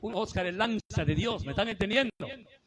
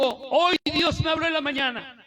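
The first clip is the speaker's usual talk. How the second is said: a hint of the evangelist's booming proclaim, higher and louder; preacher voice; 8 semitones higher, 7 dB louder than usual. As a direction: voice the preacher's announcement with lifted power. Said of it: "Gigi dijo". (voz de predicador evangelista, más alta y fuerte)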